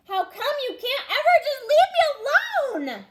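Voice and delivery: high, loud, exasperated yell; snapped at sister. Acted. Boy in timeout yelling at his little sister, furious shout. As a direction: snap it out, fed up and shouting. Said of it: "He snapped at his sis".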